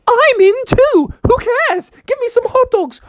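very high falsetto, eager and bold; Alice. Acted. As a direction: pipe up eagerly in a cartoon falsetto. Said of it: "Alice was like".

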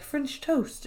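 put-on weird posh accent. Michael offering Pam breakfast, weird British accent. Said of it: "this really weird accent when they're speaking".

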